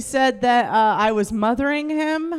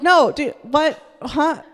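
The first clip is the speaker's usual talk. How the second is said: raised-pitch, incredulous denial; acted bafflement; 5 semitones higher, 4 dB louder, slower than usual. Comic replays answering her proud boyfriend, mildly baffled. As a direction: protest in mild mock confusion.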